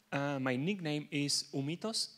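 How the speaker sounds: ordinary talk, nothing marked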